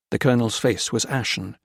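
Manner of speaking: usual speaking voice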